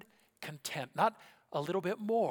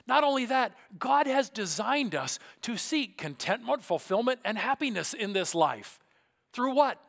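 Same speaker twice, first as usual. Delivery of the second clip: fervent preaching; 7 semitones higher, 5 dB louder than usual. Real, not acted. Preaching at full force.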